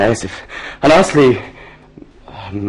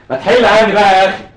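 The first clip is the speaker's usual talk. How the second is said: exasperated yell; 9 semitones higher than usual. Acted. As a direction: shout him off, fed up. Man loses patience with father.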